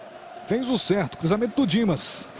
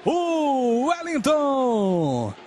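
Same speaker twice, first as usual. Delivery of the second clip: very slow stretched goal cry; drawn-out goal call; 3 semitones higher, much slower than usual. Real, not acted. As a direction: hold each vowel of the goal call.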